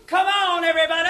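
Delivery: high, loud call to the crowd; rallying shout. Acted. Announcer to fair crowd, high rallying shout. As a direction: rouse everyone with a big holler.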